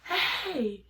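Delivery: mild mock yell, high pitched; retold scream. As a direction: act out a scream without full volume. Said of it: "And I screamed".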